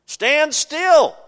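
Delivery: high, loud command; commanding Moses. Acted. Moses ordering the frightened people, loud and high. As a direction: bark the order to hold firm. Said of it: "Moses said unto the people".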